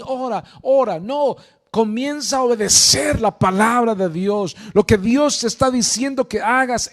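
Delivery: loud, urgent preacher's exhortation; fervent preaching. Acted. Preacher urging the congregation to obey, loud and fervent.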